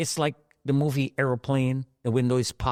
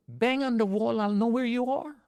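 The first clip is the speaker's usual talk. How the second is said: retold shout; 11 semitones higher than usual. Rescuer behind the wall calling to the trapped man, high mock shout. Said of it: "he's screaming".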